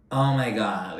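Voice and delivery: ordinary delivery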